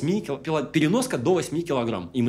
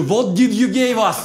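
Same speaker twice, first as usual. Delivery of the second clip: shouting; 7 semitones higher, 6 dB louder, slower than usual. Retold or imitated. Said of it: "я кричу".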